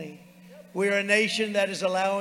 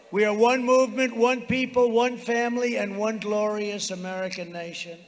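roaring rallying speech; 3 semitones higher, 4 dB louder than usual. Real, not acted. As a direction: lift the crowd with each phrase.

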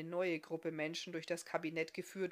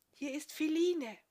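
higher woman's voice calling softly at a wagon at night; 10 semitones higher, 7 dB louder than usual. A narrator's reading. Woman outside the wagon calls softly to Goldulak, high hushed voice. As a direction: call his name gently from outside, high and hushed. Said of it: "leise rief".

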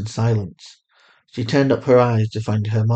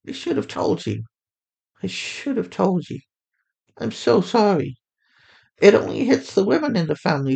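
higher mother voice, full of regret; remorseful mother; 9 semitones higher than usual. A narrator's reading. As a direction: apologize again and again, hurting. Narrator voices Fatima.

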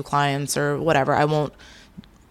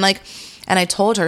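ordinary delivery

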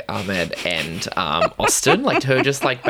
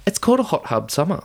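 imitating Danielle's earnest tone, higher; mock-sincere, raised pitch; 7 semitones higher, faster than usual. Mocking Danielle explaining her summer, earnestly. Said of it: "Danielle said so earnestly".

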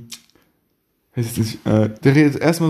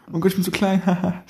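higher-pitched mock helpless girl with a giggle; mock pick-me girl; 6 semitones higher than usual. Mocking pick-me girls to a friend, high mock-cutesy voice. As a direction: put on a high little pick-me voice. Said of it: "Kennst du Pick Me Girls?".

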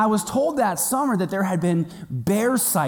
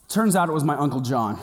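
ordinary talk

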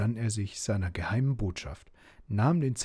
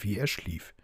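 ordinary delivery

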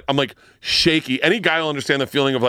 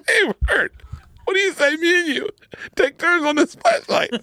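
much higher, hammed-up buddy voice through laughter; mock Rogan. Comedian imitating his friend's offer, mock high voice. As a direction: lampoon the friend offering to share. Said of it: "he's like".

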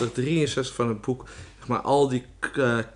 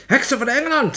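very high, loud shriek; shrieking witch; 13 semitones higher, 6 dB louder than usual. A narrator's reading. Opperheks addressing the witches of England, shrieking. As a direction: screech it sharply at the crowd. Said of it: "gilde ze".

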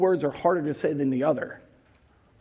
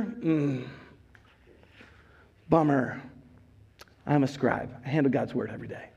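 lower, softer, dismissive bummer then a quick brag; smug scribe; 6 semitones lower, 5 dB quieter than usual. Acted. Preacher voicing a scribe who sneers at a plumber, low and smug. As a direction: pity the plumber, then boast fast.

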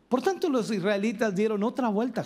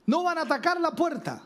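mock alarm call, pitched up high; watchman's cry; 10 semitones higher than usual. Preacher voicing the Jericho watchman's warning, mock shout. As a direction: call it out like a lookout on the wall. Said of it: "el atalaya gritó".

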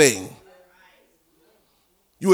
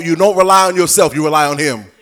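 ordinary talk

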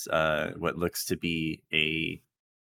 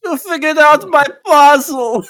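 high, loud, hammed-up villain cry; 14 dB louder than usual. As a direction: shriek it like the big bad foiled. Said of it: "That's the B B E G voice".

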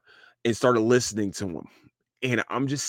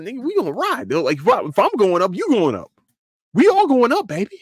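building to an excited high shout; rally the crew, rising and louder; climbing hype, peaking on all going up; hyped up; 10 semitones higher, 4 dB louder than usual. Real, not acted. Hyped about lifting friends up.

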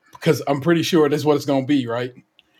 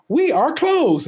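mock drive-through worker; 12 semitones higher, much slower than usual. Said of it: "they say".

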